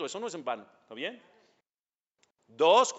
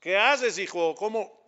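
mock scolding; 4 semitones higher, 5 dB louder than usual. Teacher scolds imagined careless student.

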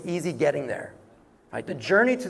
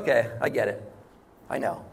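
low, softer voice of a reassuring God; understanding reply; 9 semitones lower, 4 dB quieter than usual. Acted. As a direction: acknowledge it quietly, pitched well down. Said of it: "He's like".

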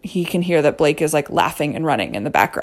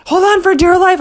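much higher, louder mock yell; 14 semitones higher, 7 dB louder than usual. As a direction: bark the warning at the boy. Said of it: "basically yelling at him".

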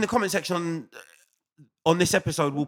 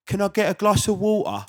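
put-on English accent; 6 semitones higher than usual. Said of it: "they always go".

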